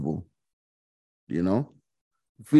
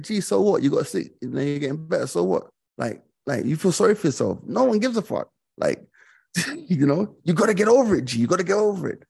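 higher, brash and dismissive; blunt Mancunian mate; 6 semitones higher, faster than usual. Retold or imitated. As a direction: brush off his self-pity like a blunt mate. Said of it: "they don't mince words".

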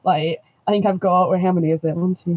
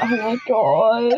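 drawn-out, slightly raised "oh my God"; reacting aloud; 3 semitones higher, slower than usual. Fan watching someone be nasty on screen, reacting aloud. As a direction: let it stretch out slowly in reaction. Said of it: "I'll be sat there like".

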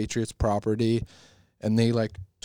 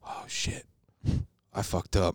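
whispered realization; 4 semitones lower, 7 dB quieter than usual. Retold or imitated. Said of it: "you could see he was like".

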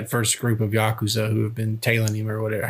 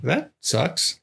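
sarcastic; 5 semitones higher, much slower than usual. Retold or imitated. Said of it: "I'm like".

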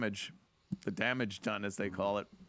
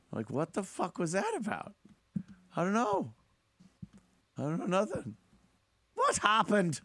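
whiny victim; 6 semitones higher than usual. Retold or imitated. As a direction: play the stunned, bleeding victim in disbelief. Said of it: "That's what he was saying".

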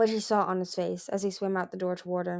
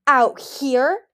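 loud, higher incredulous snap; angry disbelief; 6 semitones higher, 9 dB louder than usual. Acted. Gil scolding his son, loud angry disbelief. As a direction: throw the question back in fury.

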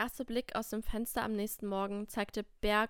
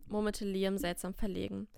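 ordinary delivery, nothing marked

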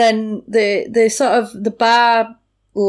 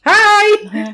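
much higher and louder, an over-the-top cheery greeting; eager hi; 12 semitones higher, 5 dB louder than usual. Retold or imitated. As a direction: greet the whole pub like an eager tourist. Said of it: "they're like".

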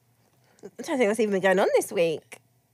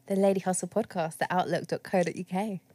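normal talking voice

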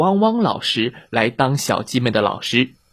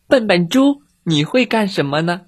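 much higher bright chick voice; 6 semitones higher than usual. Acted. Eldest chick questioning the pig, high voice.

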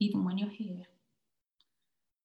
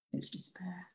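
whispering; 8 dB quieter than usual. Acted. Grief section of the poem.

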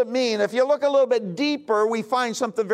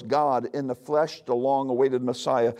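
ordinary delivery

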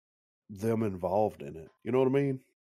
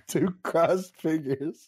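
slow, drawn-out, half-laughing; mock grand; 6 semitones higher, much slower than usual. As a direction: say it with mock reverence. Mock highbrow movie description.

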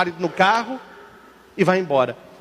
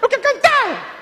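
put-on high little boy. Altar boy tells his mom he wants to sing, high child voice. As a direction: stretch it out like a small kid.

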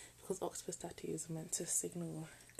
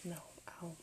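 ordinary delivery

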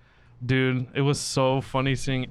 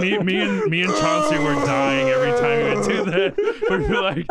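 high, laughing through the words; laughing while telling; 7 semitones higher than usual. Real, not acted. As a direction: tell it while cracking up.

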